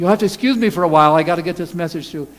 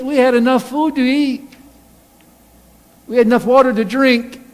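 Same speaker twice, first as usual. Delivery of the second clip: whining Israelites; 6 semitones higher, 6 dB louder than usual. Acted. Israelites complaining to Moses.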